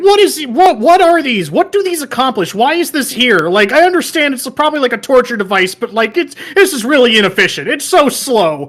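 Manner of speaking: high-pitched, incredulous retold rant. Player ranting at pointless spinning pillars, incredulous. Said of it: "I was sitting there ranting".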